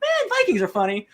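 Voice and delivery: amused aside. Retold or imitated. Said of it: "I'm like".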